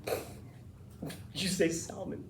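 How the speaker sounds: slow, hushed disbelief, mostly whispered; softly incredulous, very slow question; judging listener. Acted. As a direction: draw it out under your breath, as if appalled. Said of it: "People will hear that and go".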